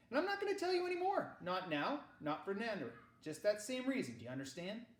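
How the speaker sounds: the uncle shuts the subject down, brisk and firm. A narrator's reading.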